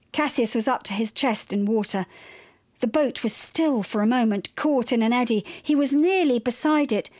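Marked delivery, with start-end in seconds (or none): none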